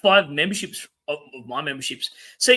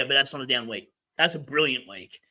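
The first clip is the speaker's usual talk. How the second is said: ordinary talk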